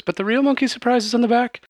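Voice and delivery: high, quick showy female voice; Kalora speaking. Acted. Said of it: "And she says".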